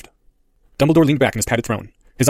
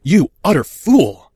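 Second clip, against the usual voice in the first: slow, loud, higher outburst; furious shout; 6 semitones higher, 6 dB louder, much slower than usual. Acted. Harry shouting at the headmaster in fury. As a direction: spit out the insult.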